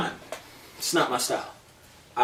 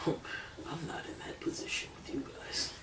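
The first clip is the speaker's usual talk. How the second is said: hushed mock whisper; retold whisper; 15 dB quieter than usual. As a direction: whisper it as someone else's words. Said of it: "He said".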